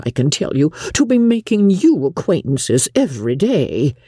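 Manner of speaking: high-pitched, effusive matron; gushing mother. Acted. Mother telling daughters what they owe father, gushing.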